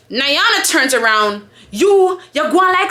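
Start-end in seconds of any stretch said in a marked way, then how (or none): 1.7-2.9 imitating a slow, higher patois call-out; high Jamaican patois accusation. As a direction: start a telling-off in drawn-out patois. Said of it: "I'ma say this in patois".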